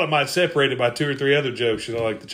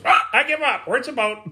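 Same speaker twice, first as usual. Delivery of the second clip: high squawky bird voice; squawk, then speak as a parrot; high-pitched parrot giving in; 12 semitones higher than usual. Acted. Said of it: "finally one day the bird goes".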